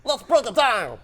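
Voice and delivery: high, squeaky tiny creature voice; chipmunk voice. Pretend. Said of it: "Chipmunk voice".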